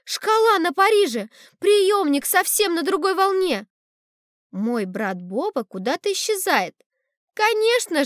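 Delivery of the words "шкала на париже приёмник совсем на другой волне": high, urgent alarmed exclamation; взволнованный крик. A narrator's reading. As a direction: cry out in dismay at the wrong station. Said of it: "кричит мама". (встревоженный высокий возглас)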